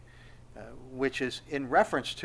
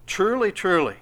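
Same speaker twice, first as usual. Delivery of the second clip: "Truly, truly" raised high and loud on both words; great emphasis; 6 semitones higher, 6 dB louder than usual. Acted. Preacher reading Jesus' answer to Peter, "Truly, truly" with great emphasis. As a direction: hit "Truly, truly" hard, higher and louder. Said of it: "the great emphasis".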